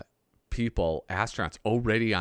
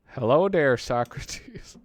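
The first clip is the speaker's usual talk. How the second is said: goofy greeting voice; 4 semitones higher, 5 dB louder, slower than usual. Retold or imitated. Voicing a character from the comic.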